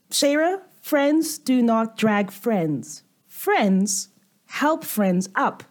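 slow, deliberate scolding; slow lecturing. Acted. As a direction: spell out the lesson slowly. Lecturing the goblin.

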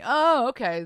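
high, loud surprised oh; surprised oh okay. Real, not acted. Host hearing the roommate twist, surprised. Reacting to living together news.

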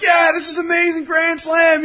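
shouted high, cheering a grand slam; excited shout. Retold or imitated. Fan cheering a grand slam in the stands, high excited shout. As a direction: yell it like the whole stadium is yours.

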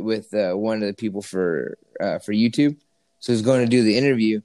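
usual speaking voice

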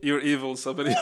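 ordinary delivery

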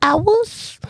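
put-on high little kid saying "I was" softly; a hint of a tiny child's voice. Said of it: "my favorite little kid voice".

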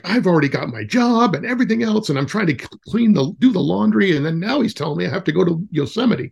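hammed-up harried parent listing chores; mock overwhelmed parent. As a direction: play a frazzled parent complaining, pitch up.

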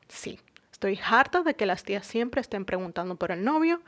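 usual speaking voice

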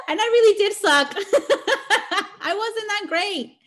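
high laughing through words. Real, not acted. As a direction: laugh at yourself while saying it. Laughing at own bad first year.

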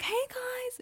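high excited vlogger hello; excited greeting. Shown as a demonstration. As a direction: greet the crowd as if thrilled. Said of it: "when someone's really excited".

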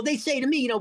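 usual speaking voice

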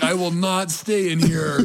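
mock-indignant, slow French accent; hammed-up French accent, drawn out; poor French accent. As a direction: play an affronted Frenchman with a bad French accent. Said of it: "that's a really poor French accent".

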